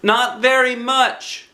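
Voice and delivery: put-on high, slow child voice; lightly voiced boy. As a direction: answer like a gentle little boy. Christopher voiced.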